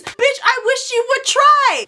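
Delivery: high, drawn-out indignant dare; defiant comeback. Retold or imitated. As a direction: throw the dare back at full pitch. Retold defiant comeback in quarrel.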